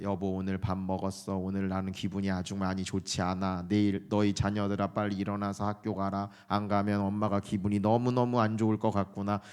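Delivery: low, soft monotone family talk; mock formal drone, drained of all feeling; uniform tone. Shown as a demonstration. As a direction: recite everyday chat on one unvarying low note. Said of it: "이렇게 대화하지 않잖아요".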